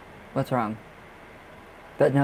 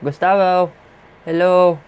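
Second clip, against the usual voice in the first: higher, louder call; calling out loud and high to a spirit; 5 semitones higher, 7 dB louder than usual. Real, not acted. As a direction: sing out his name to get his attention.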